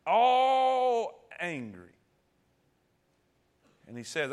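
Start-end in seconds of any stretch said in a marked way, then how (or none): 0.1-1.9 mock angry. Preacher acting out Peter's anger.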